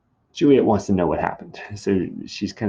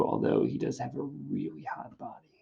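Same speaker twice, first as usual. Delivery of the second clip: hushed, confidential admission; whispered aside; 3 semitones higher, 8 dB quieter than usual. Acted. Nurse admitting Romeo's good looks, whispered aside. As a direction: whisper it like juicy gossip.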